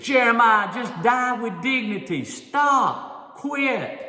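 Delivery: high, loud shouted taunt; a frog shouting at Jeremiah to quit, loud and high; harsh jeering shout. Acted. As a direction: yell him down, telling him to quit. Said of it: "Someone said".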